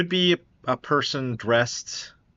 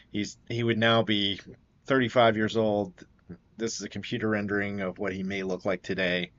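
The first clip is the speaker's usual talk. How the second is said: ordinary talk, nothing marked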